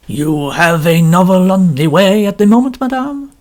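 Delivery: courteous Belgian detective, unhurried; Belgian French accent. A narrator's reading. Poirot asks the novelist.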